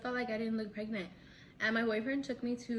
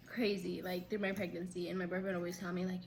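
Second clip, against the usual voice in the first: ordinary delivery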